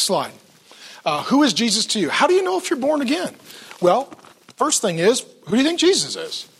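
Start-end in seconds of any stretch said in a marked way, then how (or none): none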